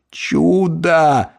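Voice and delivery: drawn-out, tearful wonder; sobbing. A narrator's reading. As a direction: utter it as if through tears of relief. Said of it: "рыдая, вымолвил он". (сквозь слёзы, протяжно)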